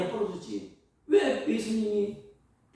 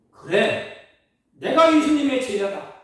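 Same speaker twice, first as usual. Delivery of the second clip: loud, proud declaration of faith; bold confession; 4 semitones higher, 9 dB louder than usual. Pretend. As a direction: proclaim it boldly as Peter should have. Imagined bold confession of Peter.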